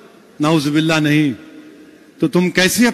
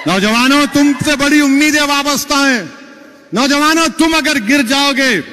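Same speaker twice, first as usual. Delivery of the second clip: put-on higher, raised preaching call to the young; rally the young men like a preacher at full force; impassioned appeal; 7 semitones higher than usual. Appeal to the youth. (جوشیلی پکار)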